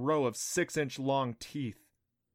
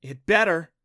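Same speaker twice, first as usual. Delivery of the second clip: loud, raised retort; defiant; 5 semitones higher, 9 dB louder than usual. A narrator's reading. Clancy wanting the beast to see her, defiant. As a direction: throw it back loudly, ready to fight. Defiant reply to frightened warning.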